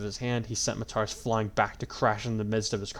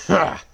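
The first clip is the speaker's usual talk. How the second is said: loud scornful villain laugh; mocking laugh; 8 semitones higher, 11 dB louder than usual. A narrator's reading. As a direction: bark a contemptuous laugh. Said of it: "the possessed man laughed".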